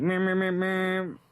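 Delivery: high, drawn-out vocal beeps; imitated truck horn. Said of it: "beeps at me".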